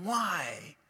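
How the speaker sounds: ordinary delivery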